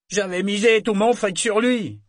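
high, loud cry of a losing bettor; angry outcry. A narrator's reading. Un marin ruiné s'écrie, cri fort et aigu. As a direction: shout your loss to the room. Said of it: "s'écria un marin".

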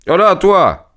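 appel bref, très aigu; héler quelqu'un au loin; interpellation criée. A narrator's reading. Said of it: "cria-t-il au valet d'écurie". (cri d'appel impérieux)

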